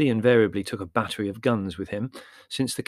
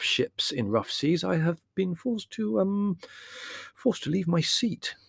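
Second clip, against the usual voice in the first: precise, stammering scholar; hesitant scholar; 6 semitones higher than usual. Acted. Theodore's halting precise voice.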